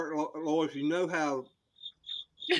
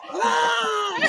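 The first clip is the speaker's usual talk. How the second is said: high startled scream; 6 dB louder than usual. Retold or imitated. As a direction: shriek in fright. Scream when walking in.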